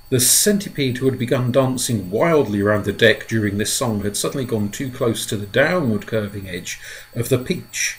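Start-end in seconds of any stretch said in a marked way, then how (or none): none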